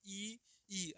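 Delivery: ई ई drawn out with tongue pulled back; stretched ई demo. Shown as a demonstration. Teacher showing how tongue pulls for ई. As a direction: hold the ई long and tight. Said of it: "जीभ घिंचाएगा".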